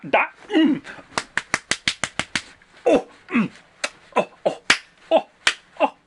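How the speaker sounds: high, fawning mm and oh sounds; swooning moans. Real, not acted. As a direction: moan over the bromance. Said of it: "I should not have made those noises".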